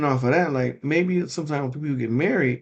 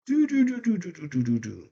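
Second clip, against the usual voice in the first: machine beeping; 5 semitones higher, 5 dB quieter than usual. Retold or imitated. Machine switching noise.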